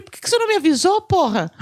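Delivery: high, loud angry shout; annoyed shout at colleague; angry yell. Retold or imitated. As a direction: yell at him for not warning.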